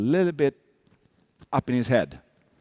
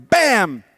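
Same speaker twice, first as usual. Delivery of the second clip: a loud, punchy mock bang; imitated thud; 7 semitones higher, 8 dB louder than usual. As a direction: smack the sound out like a fall. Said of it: "if you hear".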